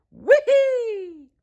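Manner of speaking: very high gleeful squeal; pure delight; joyful whee. A narrator's reading. Toad squealing with joy at being alive.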